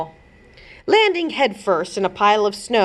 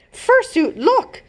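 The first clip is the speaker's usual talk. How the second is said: high excited call to her wolf; higher, bright call of alarm; excited princess call; slower than usual. A narrator's reading. Princess calls Fursuit to the window, high and excited.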